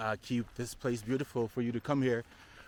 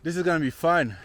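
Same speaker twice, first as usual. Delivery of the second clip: excited; 4 semitones higher, 8 dB louder than usual. Real, not acted. Tired at hike start, whoops.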